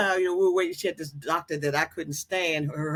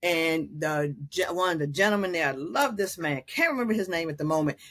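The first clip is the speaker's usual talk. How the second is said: ordinary delivery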